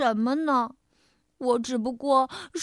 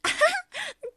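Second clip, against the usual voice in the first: happy laugh. Acted. Mouse delighted to be good guy.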